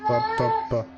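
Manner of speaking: put-on squeaky baby babble, very high. Mimicking baby noises, high.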